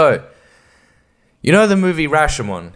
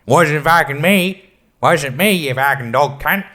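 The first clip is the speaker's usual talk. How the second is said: mock perpetrator. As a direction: deny it like a hammed-up lout. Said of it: "Perpetrator tells their story. They say".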